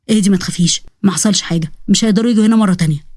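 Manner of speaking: low, calming protector's voice; calm, protective reassurance; reassuring. Acted. Protector reassures frightened girl.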